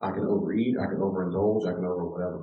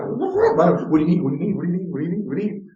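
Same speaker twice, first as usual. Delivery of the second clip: doting parent; 8 semitones higher, 4 dB louder than usual. Retold or imitated. Parent fussing over a crying infant, cooing high. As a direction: coo over the baby again and again.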